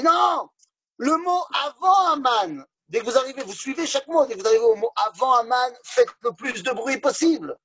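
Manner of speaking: high, insistent shouting rabbi; urgent rabbi shout. Acted. Rabbi urges noise.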